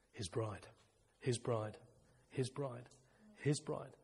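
hushed. Real, not acted. Hushed repeated devotional phrase.

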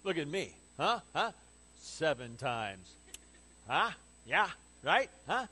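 proud, prodding little "huh?"s; self-satisfied showing off. Retold or imitated. Peter fishing for Jesus' praise, smugly. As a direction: fish for approval, mock self-important. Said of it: "Peter thinks he's being magnanimous".

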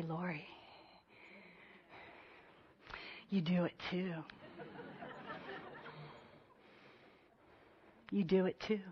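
soft, hushed gentle rebuke; a still small voice, low and soft; Holy Spirit's voice. Retold or imitated. The Holy Spirit speaking to Lori, softly and gently. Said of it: "And I heard the Holy Spirit say".

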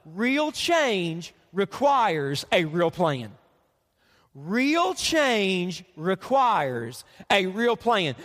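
slow, deliberate, every word weighted; slow emphasis. Shown as a demonstration. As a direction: lay it down slowly like a key line. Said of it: "you might wanna write this one down".

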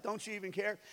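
low, softly muttered mock complaint; bad attitude. Believer mad at God, soft bad-attitude grumble. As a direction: let the resentment slip out under your breath. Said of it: "slips out in their bad attitude".

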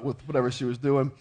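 ordinary talk, nothing marked

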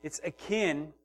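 normal talking voice

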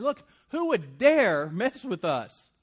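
slow, loud and cocky, daring anyone; mock trash-talking challenge. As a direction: taunt the enemy like Paul talking smack. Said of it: "Paul is talking smack here".

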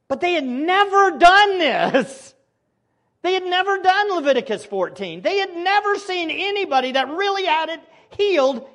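high, loud impassioned preaching; preacher building to a peak; fervent preaching. Real, not acted. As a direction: drive the point home from the pulpit. Preaching at full force.

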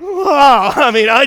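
loud, high, drawn-out wow; re-enacted burst of disgusted amazement; grossed-out shock. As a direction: recoil with a comic cry. Acts out being grossed out.

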